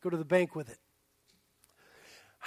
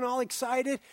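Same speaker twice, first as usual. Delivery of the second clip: ordinary delivery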